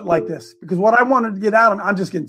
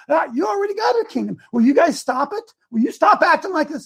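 mad Jesus; 8 semitones higher than usual. Retold or imitated. Preacher voicing Jesus scolding bickering disciples, exasperated shout. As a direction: scold them like a fed-up teacher. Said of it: "and he got mad".

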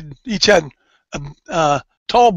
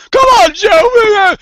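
pleading whine; 13 semitones higher, 7 dB louder, much slower than usual. Retold or imitated. Scared kid begging big brother.

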